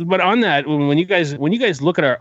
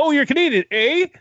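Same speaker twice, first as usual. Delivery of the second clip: much higher, stretched eh at the end; mock Canadian accent; 13 semitones higher than usual. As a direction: tease him with a hammed-up Canadian eh. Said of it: "He's like".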